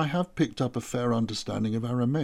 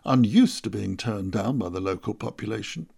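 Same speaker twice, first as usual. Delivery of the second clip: plain reading voice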